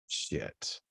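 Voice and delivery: breathed under the breath; muttered curse. Real, not acted. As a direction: swear quietly to yourself. Recording mishap, muttered.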